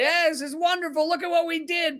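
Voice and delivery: high-pitched gloating cheer, hammed up; mock celebration. Host mimics officials celebrating a blown-up bridge, mock jubilation.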